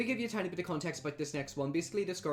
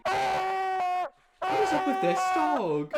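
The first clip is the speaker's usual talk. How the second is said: loud, slow, high outraged cry; high drawn-out shout of disbelief; 8 dB louder, much slower than usual. Real, not acted. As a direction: yell it at the screen, stretched out. Reacting to barking dog.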